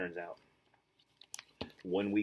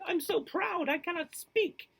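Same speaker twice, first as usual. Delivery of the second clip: excited; 4 dB louder, slower than usual. Acted. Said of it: "she shouted".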